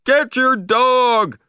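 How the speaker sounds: high, loud and slow angry demand; angry shout. A narrator's reading. Beachgoer ordering the owner to get the dog, angry shout. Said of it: "somebody shouted angrily".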